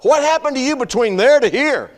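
high, loud disbelief; exasperated shock at the pastor's voice; incredulous. Retold or imitated. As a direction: blurt it out, baffled. His exasperated inner reaction.

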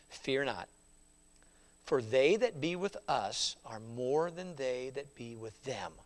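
soft, low, steady comfort; calm reassurance. Acted. Elisha calming his frightened servant, soft and reassuring. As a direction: settle his fear gently.

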